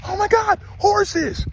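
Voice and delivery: very high imitated shriek of surprise; astonished exclamation. Wife spotting horses while leaving, astonished exclamation. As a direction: cry out high, imitating sudden amazement. Wife's sudden exclamation, retold.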